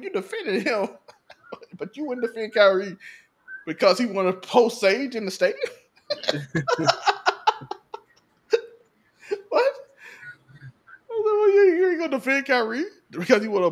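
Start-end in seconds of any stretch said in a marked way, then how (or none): 6.1-8.0 long laugh. Real, not acted. Laughing at own point.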